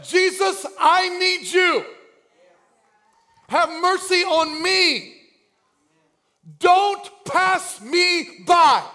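high, slow, pleading shout; urgent drawn-out cry for help. Acted. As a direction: beg loudly, stretching every word. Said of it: "he cried out".

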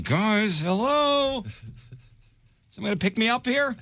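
high mock cry for help on stage; mock panicked plea. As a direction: pretend to be stranded, calling for rescue. Retold panicked call for help.

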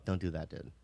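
low, very soft reproof; quiet correction. Retold or imitated. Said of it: "and I go".

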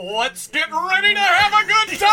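loud hype announcer; announcer shout. Pretend.

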